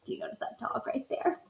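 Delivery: hushed unvoiced aside to the class; whisper. Real, not acted. Teacher whispering to her class about the sneaky dog. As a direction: whisper it like a shared secret.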